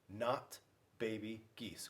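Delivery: ordinary delivery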